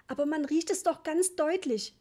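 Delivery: high, loud, indignant protest; worked up. A narrator's reading. Mother still insisting on the gas smell, worked up. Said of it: "rief Mama aufgebracht".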